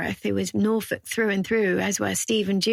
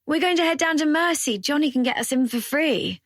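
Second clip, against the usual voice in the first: much higher, louder, bursting with news; excited; 7 semitones higher, 4 dB louder than usual. A narrator's reading. Zoe telling Casey about the free club entry, excited. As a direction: pitch it up, can't wait to go. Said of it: "her tone excited".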